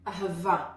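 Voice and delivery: ahava with a breathy A at the end, air pushed out on the last syllable; breathy final A. Shown as a demonstration. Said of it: "A with more air coming out".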